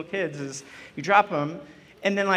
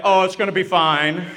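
offhand doctor with a laugh; breezy doctor; 5 dB louder than usual. Retold or imitated. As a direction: dismiss it, shrug it off. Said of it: "they're like".

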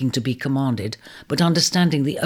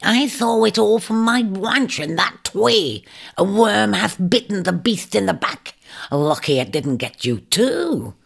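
crafty shrike; 7 semitones higher than usual. Acted. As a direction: pipe it up high like a sly bird. Shrike speaks to Ares.

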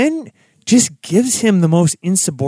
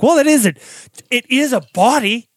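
pitched way up, mock disbelief; incredulous retort; 10 semitones higher than usual. Answering Mulder's complaint with high mock disbelief. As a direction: squeak it out like it's obvious.

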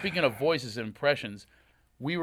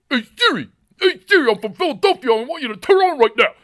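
much higher, louder mock Philly voice; [name] doing the host; 14 semitones higher, 13 dB louder than usual. [name] imitating the host's Philly voice at Siri. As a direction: parody a Philadelphian yelling at the phone. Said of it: "this is him doing me".

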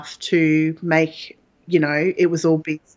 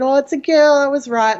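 higher and louder, thrilled and proud; delighted exclamation; 8 semitones higher, 4 dB louder than usual. Retold or imitated. New mother seeing her baby girl, higher louder delighted cry. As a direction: burst out with joy at being right. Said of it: "And I said".

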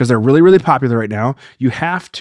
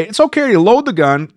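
much higher, prissy mock voice; mocking their advice; 7 semitones higher than usual. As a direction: mimic the people giving bad advice. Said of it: "I've seen this where they say".

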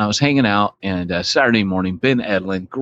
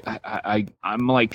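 ordinary talk, nothing marked

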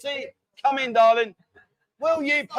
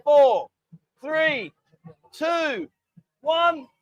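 rising countdown, each number higher; countdown call; 5 semitones higher, 4 dB louder, much slower than usual. Real, not acted. Host timing a drinking challenge, calling out the countdown. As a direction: call out each number separately, climbing in pitch.

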